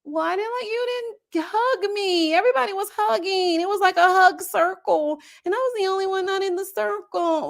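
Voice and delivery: high, whiny mock complaint; imitating Chelsea whining to her boyfriend about the hug circle. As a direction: pout it out like a sulking girlfriend. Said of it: "she starts whining".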